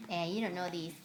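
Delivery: normal talking voice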